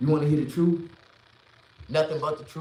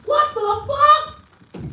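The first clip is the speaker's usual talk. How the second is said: very high, loud, drawn-out what the fuck; squealing mock outrage; stunned disbelief; 8 dB louder, much slower than usual. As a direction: stretch the second one out in shock. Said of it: "I'm like".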